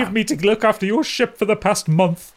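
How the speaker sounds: high, loud, huffy telling-off; indignant octopus scolding. Acted. Orapan slapping Calbo and scolding him, high and loud. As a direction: scold him in a shrill put-on squeak. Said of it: "And goes".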